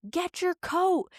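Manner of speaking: high, slow mock holler; imitating yelling mom. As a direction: call out an order like mom, stretched out. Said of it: "Then she goes".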